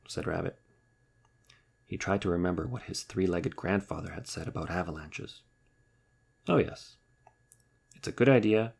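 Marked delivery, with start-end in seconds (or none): none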